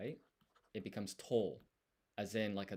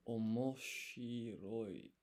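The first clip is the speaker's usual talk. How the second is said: omoshiroi drawn out slowly, syllable by syllable; slow practice repeat; much slower than usual. Shown as a demonstration. Tutor slowly sounding out omoshiroi for the viewer. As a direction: stretch omoshiroi out, slowly and carefully. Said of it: "drawn-out practice repeat".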